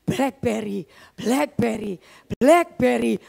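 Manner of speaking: low, insistent chant of Blackberry, Blackberry, Blackberry, in time with the heartbeat; heart's inner voice. Retold or imitated. Her racing heart keeps chanting the phone's name, low and rhythmic.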